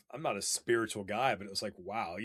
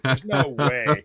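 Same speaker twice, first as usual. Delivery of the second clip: loud, slow, drawn-out "no way"; doubting a friend who claims he saw a python; scoffing disbelief; 10 dB louder, much slower than usual. Retold or imitated. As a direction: stretch it out like you don't buy it.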